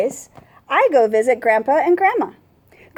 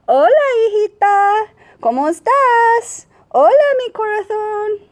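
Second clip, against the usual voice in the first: loving grandmother; 10 semitones higher than usual. A narrator's reading. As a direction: coo at the granddaughter like a doting grandma. Abuelita greets the granddaughter.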